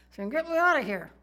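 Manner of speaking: mock unborn baby pleading to get out; baby in the womb. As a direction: squeal it like a baby wanting out. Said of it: "your child going".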